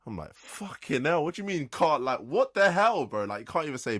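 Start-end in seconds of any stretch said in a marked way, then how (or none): 0.3-3.2 louder, high-pitched exasperated rant; outraged disbelief. Retold or imitated. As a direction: act out being appalled at a rude order. Acting out outraged reply to rude customer.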